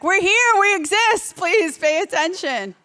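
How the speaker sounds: high, loud plea for attention; mock screaming. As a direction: cry out high, slowing on the final plea. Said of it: "We're always screaming".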